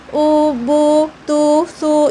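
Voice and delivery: "ऊ, बू, तू, सू" one by one with a clear oo, not dragged; unstretched maroof vowels. Shown as a demonstration. Teacher showing how to read pesh, clear unstretched oo. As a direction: separate even syllables "ऊ, बू, तू, सू". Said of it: "इस तरीके से, ठीक है, मारूफ पढ़ना है".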